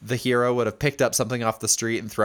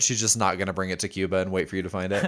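ordinary talk

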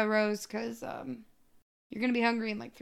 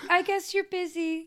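put-on high, small kid voice; mock-wounded little daughter; dejected child; 6 semitones higher than usual. Daughter giving up on her busy dad, higher child voice. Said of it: "And she's like".

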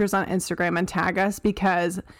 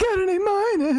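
lightly mock-grumpy teen; 11 semitones higher, faster than usual. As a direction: sulk it like a grumpy eighth grader. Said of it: "my grumpy eighth grade voice".

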